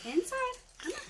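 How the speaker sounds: very high-pitched call to the dogs; coaxing pets. Real, not acted. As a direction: coax them indoors in a high voice. Calling the dogs inside.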